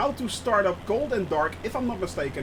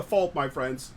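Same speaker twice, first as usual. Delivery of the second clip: normal talking voice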